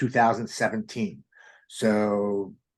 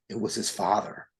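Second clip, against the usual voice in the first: usual speaking voice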